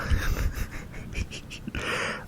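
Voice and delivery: soft laugh. Real, not acted. Laughs at herself.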